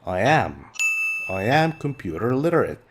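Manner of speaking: ordinary talk, nothing marked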